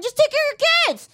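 high, far-off preachy know-it-all voice; high mocking voice, scoffing at the struggle. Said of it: "They're just like".

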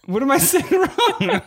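high, drawn-out laughing squeal; laughing protest. Real, not acted. Husband or wife corrected on a pronunciation, laughing protest. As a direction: squeal it slowly through helpless laughter.

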